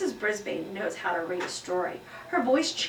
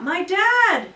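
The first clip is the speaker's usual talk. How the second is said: loud, high classroom shout; child calling out loud and high; 10 semitones higher, 10 dB louder than usual. Acted. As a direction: blurt it from the back of the room. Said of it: "A voice shouted out".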